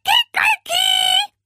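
very high, loud crowing; rooster crow. A narrator's reading. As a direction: crow shrilly like a rooster at dawn. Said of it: "Hatte sich etwa ein Hahn".

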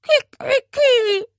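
high, loud wailing rooster cry; sulking parrot's piercing complaint; plaintive crow. A narrator's reading. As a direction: crow it as a lament. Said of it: "ertönte es klagend".

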